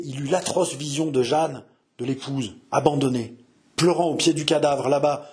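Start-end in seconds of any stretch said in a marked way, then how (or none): none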